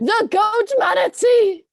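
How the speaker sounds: a goat crowing his own pun; hammed-up exclamation.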